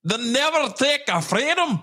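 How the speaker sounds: high, hammed-up Scottish cry; attempted Scottish accent. Streamer quoting a battle cry for laughs, hammed-up Scottish accent. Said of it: "a, uh, Scottish accent".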